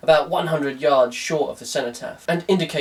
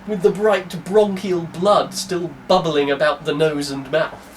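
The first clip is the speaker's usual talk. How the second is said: normal talking voice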